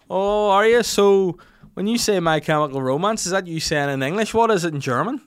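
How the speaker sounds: loud, high-pitched nosy questioning; brash tipsy interest. Retold or imitated. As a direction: quiz him with overconfident drunken enthusiasm. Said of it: "obnoxiously confident".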